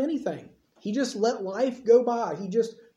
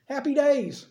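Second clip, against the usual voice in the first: overjoyed, higher and bright with relief; mock-happy Hannah, pitched up with joy; rejoicing; 7 semitones higher than usual. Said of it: "She goes away rejoicing".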